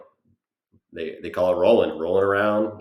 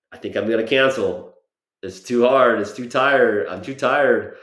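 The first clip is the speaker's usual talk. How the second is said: mock whining, louder and higher; whiny excuse; 4 semitones higher, 6 dB louder than usual. Tired after the Dallas drive, he whines about cancelling. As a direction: complain like a worn-out kid. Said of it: "And I just said".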